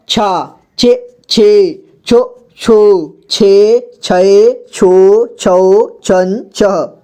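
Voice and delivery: slow छ series, each syllable apart; syllable drill. Shown as a demonstration. Teacher recites छ series so learners can read, slow drill. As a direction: recite each syllable, drawing out the long vowels. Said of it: "ताकि आपको सुनकर सभी को पढ़ना आ जाए".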